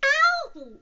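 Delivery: very high, loud "ow" squeal; crying out. Acted. Piglet being scrubbed in the bath, loud yelp. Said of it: "cried Piglet".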